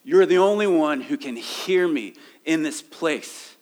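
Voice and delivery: earnest acted cry for help. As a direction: call out to God as the psalmist. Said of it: "psalmist who is crying out".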